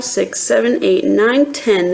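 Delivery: plain reading voice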